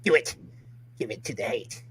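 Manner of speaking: lightly voiced evil emperor, low and quiet; a hint of Darth Sidious, retold from a game night. Friend retelling a game night, light hint of Sidious. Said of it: "he did the Darth Sidious voice".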